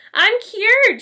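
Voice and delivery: put-on a cured girl shouting with joy; joyful shout. A narrator's reading. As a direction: yell it out in relief. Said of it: "she shouted".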